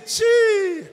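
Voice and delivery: long, very high, loud squealed syllable; demonstrated high-pitched exclamation. Shown as a demonstration. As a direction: let out a sustained piercing cry.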